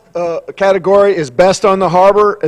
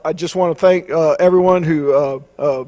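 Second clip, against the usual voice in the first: usual speaking voice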